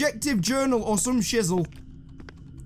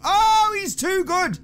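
startled; 10 semitones higher, 4 dB louder, slower than usual. Real, not acted. Monster catches up.

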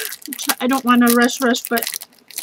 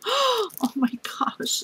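slow, high drawn-out oh my gosh; amazed by yet another gift; high awed exclamation; 9 semitones higher, 5 dB quieter, much slower than usual. Real, not acted. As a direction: let it float up in amazement. Overwhelmed after gasp.

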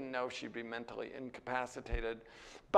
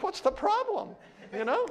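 higher and louder, brushing off the complaint; dismissive mock retort; 14 semitones higher, 7 dB louder than usual. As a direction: shrug it off, pitched high and loud. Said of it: "And I was like".

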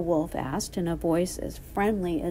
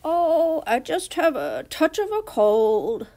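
loud, squeaked fake-granny voice; squeaky wolf; 8 semitones higher, 6 dB louder than usual. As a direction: squeak out an excuse as the disguised wolf. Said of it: "squeaked the wolf".

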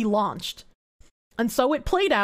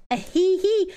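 mischievous giggle; 5 semitones higher than usual. Real, not acted.

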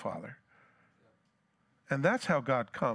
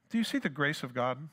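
normal talking voice

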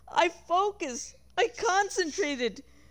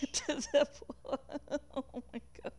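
real laughter; 3 semitones lower, 9 dB quieter, much faster than usual. Real, not acted. Reader cracking up at screen.

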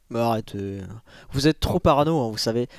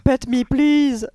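high, slow cutesy beg; cutesy pleading voice; 9 semitones higher, 4 dB louder, much slower than usual. Pretend. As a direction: coo it like a pet begging. Reads cute site name, hammed.

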